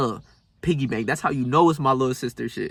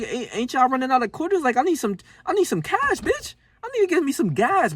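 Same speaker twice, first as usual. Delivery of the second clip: much higher squeaky pleading demand; mock demand; 11 semitones higher than usual. Teller replaying pestering a Dunkin' worker for cash, squeaky high demand. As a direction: pitch it way up, pushy and exasperated.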